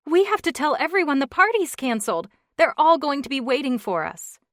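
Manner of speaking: a wife suddenly remembering; sudden alarm. Acted. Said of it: "her eyes flew wide open".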